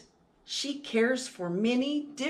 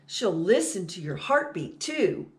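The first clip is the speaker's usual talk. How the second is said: ordinary delivery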